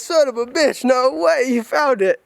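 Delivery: high, excited disbelief; stunned, delighted cursing; amazed outburst. Retold or imitated. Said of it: "My head goes".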